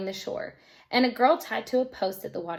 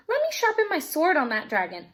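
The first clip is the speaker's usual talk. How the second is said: high confident boast; eager brave boast, higher; bold boast; 7 semitones higher than usual. Acted. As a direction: swagger toward the dragon.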